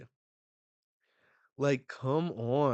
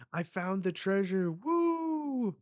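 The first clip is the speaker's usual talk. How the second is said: much higher and louder, whooping at the end; excited cheer; 9 semitones higher, 4 dB louder than usual. Acted. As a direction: burst with joy at the find. Finding the treasure.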